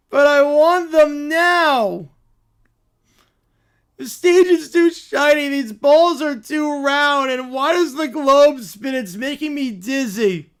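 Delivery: put-on bratty whining child. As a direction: demand it now like a brat. Bratty character complains.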